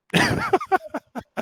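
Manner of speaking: very high squealing laugh. Real, not acted.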